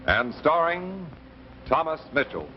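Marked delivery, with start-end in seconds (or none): none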